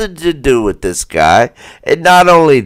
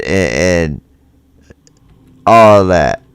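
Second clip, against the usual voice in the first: usual speaking voice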